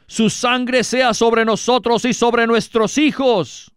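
high, slow collective declaration; crowd declaring. A narrator's reading. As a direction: voice the whole people answering in defiance. The people accept the blood.

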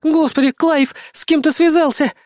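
high, aghast woman's voice; horrified. A narrator's reading. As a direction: gasp it out in dismay. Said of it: "ужаснулась Раиса".

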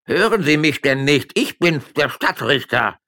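high, loud indignant call; indignant shout. Acted. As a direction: insist on your rank loudly. Judge shouting up at window. (Stadtrichter ruft empört zum Fenster hinauf)

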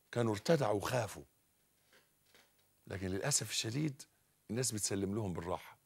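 ordinary delivery

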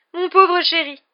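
high, loud, melodramatic pity; tragic seer. Acted. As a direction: lament over the doomed pupil. Seer with tragic look pities boy.

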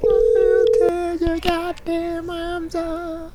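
high, very slow menacing threat. Acted. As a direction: drag out the threat. Growled threat line.

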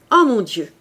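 loud exclamation of dismay; old man's shocked outburst; startled cry. A narrator's reading. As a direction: cry out in alarm, briefly. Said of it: "s'écria Fauchelevent".

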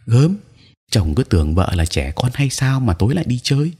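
high-pitched, lightly scoffing retort; mock indignation. Wife answering her husband's warning, high and mildly indignant. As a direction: scoff at being treated like a child.

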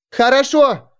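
high, loud call across. Acted. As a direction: call out encouragingly. Sunshine calls to Alex on mast.

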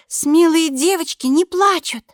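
put-on slow, high child's brave recital; little girl voice. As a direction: say it like a kid being brave. Girl recites, fighting tears.

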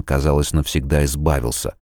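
plain reading voice

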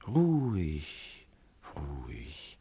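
slow, fading to a whisper; soothing hush. Acted. Jupiter soothes weeping Alkmene.

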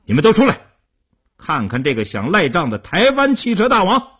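high, loud summons to the next room; commanding, scornful shout. A narrator's reading. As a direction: call the gang out to jeer at a debtor. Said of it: "提高声音对里屋喊道".

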